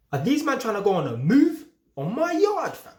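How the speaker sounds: outraged at strangers eyeing his yard; outraged disbelief. Retold or imitated. Telling listeners about men near his yard, outraged.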